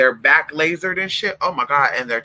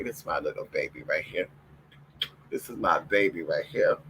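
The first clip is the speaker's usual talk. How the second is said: soft cooing; 11 semitones lower, 10 dB quieter than usual. Real, not acted. Cooing at her dog.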